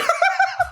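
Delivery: high shrieking laugh; real helpless laughter. Real, not acted. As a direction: crack up at the screen. Laughs at the AI story.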